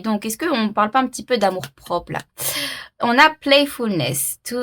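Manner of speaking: ordinary talk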